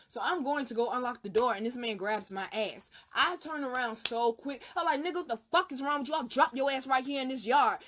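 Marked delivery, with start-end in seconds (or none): 5.0-7.8 fast, high, angry telling-off; angry retort. Retold or imitated. Woman to drunk man who grabbed her, fast furious threat. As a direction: snap back hard, ready to swing.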